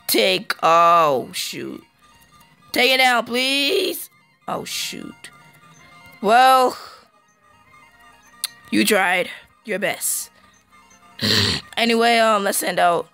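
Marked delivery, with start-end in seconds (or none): none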